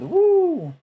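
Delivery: high, loud cheer; excited whoop. Real, not acted. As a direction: let out a happy whoop. Cheer about the workshop.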